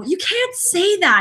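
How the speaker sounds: high, drawn-out protest; mock scolding. Co-host reacting to her friend's remarks, mock scolding. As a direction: scold a friend in exaggerated disbelief.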